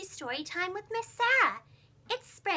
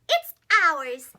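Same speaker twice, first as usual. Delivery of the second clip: proud; 10 dB louder than usual. Acted. Happy conclusion.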